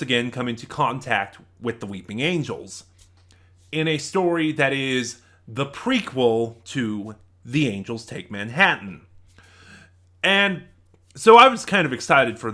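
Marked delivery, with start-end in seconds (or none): none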